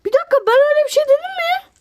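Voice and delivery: yüksek sesle, hızlı ve tiz çıkış; hızlı, tiz ve yüksek sesle itiraz; bağırarak isyan. Real, not acted. Yayıncı oyunda isyan ediyor. (şaşkın ve öfkeli bağırış)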